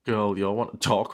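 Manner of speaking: normal talking voice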